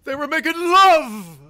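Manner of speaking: loud, high outburst with a drawn-out LOVE; slow theatrical cry of accusation; hammed-up horror-villain outrage; Mrs. Voorhees. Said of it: "Do we get a Missus Voorhees?".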